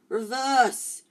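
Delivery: sharp urgent shout; yelled. A narrator's reading. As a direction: yell at the car to back away. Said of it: "Harry yelled".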